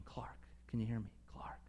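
soft, low fatherly whisper. Retold or imitated. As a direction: whisper it gently across the barn. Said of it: "he just starts whispering".

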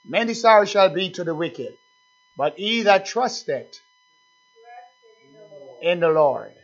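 intoned Bible verse, slow and loud; scripture reading. Acted. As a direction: lean on each word of the verse. Preacher reading scripture with force.

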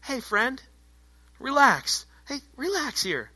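hushed, lifted "easy now" tone; mock soothing. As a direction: gently talk down someone who is all worked up. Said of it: "get to be the person that says".

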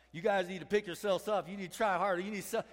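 loud, high mock exhorting; mock pep talk. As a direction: hector them like a pushy motivator. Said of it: "Man, not like".

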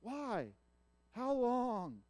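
high, loud anguished plea; anguished lament. Acted. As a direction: cry out in grief to God. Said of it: "then he goes".

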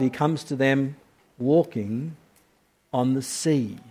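he comes to them walking on the sea, each phrase weighed; hushed wonder at walking on the sea; slow and deliberate. Shown as a demonstration. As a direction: lay it out slowly, soft and amazed. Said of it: "We say that again".

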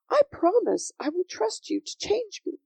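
put-on high, earnest little-girl promise; earnest cry. A narrator's reading. Sophie promises Yeshua her trust, high and earnest. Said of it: "cried Sophie".

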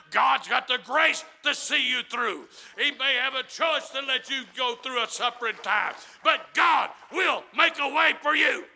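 loud shouted preaching; fiery preaching. Acted. Preacher assuring the struggling congregation loudly. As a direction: push it out with conviction.

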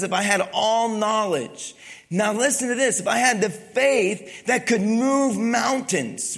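a preacher driving home the verse; preaching build. Acted. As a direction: build the verse toward its peak. Reads scripture with rising force.

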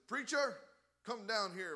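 loud, high imitation of an angry man; upset challenger. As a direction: call the preacher out, acting the heckler. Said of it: "man got upset with him".

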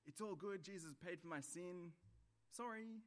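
high, breezy mock apology; cheerful sorry. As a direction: brush it off chirpily, as if it costs nothing. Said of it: "or a cheerful sorry back to God".

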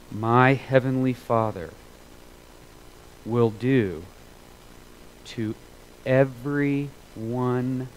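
slow, weighty scripture reading; slow deliberate reading of the verse; read slowly. Shown as a demonstration. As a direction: let each word settle in slowly. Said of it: "I'm gonna read it slowly".

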